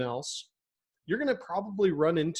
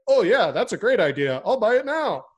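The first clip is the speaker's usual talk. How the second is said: sarcastic eager buyer; 5 semitones higher, 8 dB louder than usual. Retold or imitated. Mock customer instantly convinced.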